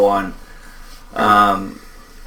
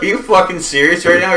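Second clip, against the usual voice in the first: higher and louder, stunned disbelief; disbelieving outburst; 8 semitones higher, 6 dB louder than usual. Retold or imitated. Retelling confronting colorblind friend, loud stunned disbelief. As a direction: hit it like you can't believe your ears. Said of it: "I was like".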